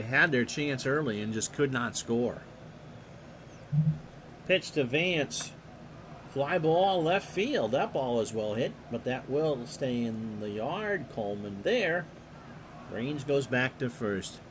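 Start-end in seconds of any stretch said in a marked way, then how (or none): 4.5-14.4 steady announcer call of the action; play-by-play. Acted. Announcer calling the pitch to the listeners, play-by-play. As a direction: call the game like a radio announcer.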